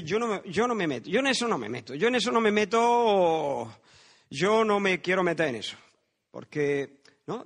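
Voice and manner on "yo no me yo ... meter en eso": bystander refusing involvement. Acted. Preacher acts out someone who won't get involved, exaggerated refusal. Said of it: "El que dice".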